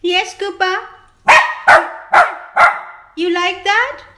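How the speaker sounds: high sing-song voice for the dog; high pet-talk voice. Real, not acted. Talking to her dog Cooper.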